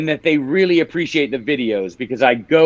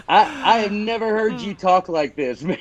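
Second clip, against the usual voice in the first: higher-pitched mock disbelief, as his wife; imagined wife's surprise; 5 semitones higher than usual. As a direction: lightly put on a wife's astonished voice. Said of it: "I guarantee she's like".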